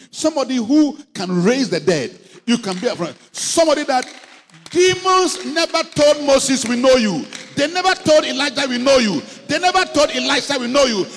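loud, rising sermon cry; fiery preaching. Acted. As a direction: preach it out at full force to the congregation. Preaching at full force.

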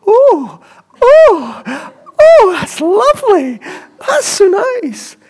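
high, loud mock Glaswegian lady, close to tears; moved Glaswegian lady. Glaswegian lady being prayed for, in a high, teary mock Glaswegian accent. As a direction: imitate her being overcome, in a weak Glaswegian accent. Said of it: "I could hear one of them going".

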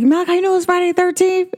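high, fast playful tease; teasing son. Retold or imitated. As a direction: spook the kid playfully. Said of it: "I was like".

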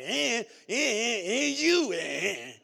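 loud, high, drawn-out mock whining; whining complainer. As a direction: imitate a complainer wailing at full volume. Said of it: "all they do is complain".

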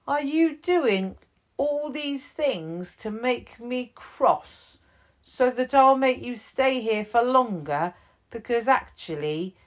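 gently working out the bear's fear; kind chief. Acted.

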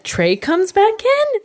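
high, slow, disbelieving; surprised question. Retold or imitated. Reading the next note to her cohost, stunned and slow. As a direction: pitch it way up in shock. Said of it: "I just read the next note and I said".

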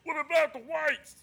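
high put-on voice. Intruder voice, S1 asks who.

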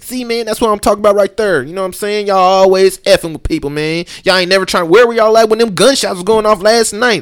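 loud, high mock confrontation; officer imitating a heckler at an arrest; heckling bystander. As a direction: play an angry young guy yelling at the cops. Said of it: "and he's like".

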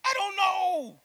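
hammed-up frustrated answer; mock exasperated. Bitter wife answering an offer of ice cream, loud exasperation.

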